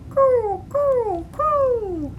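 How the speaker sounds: pigeon coo. Acted.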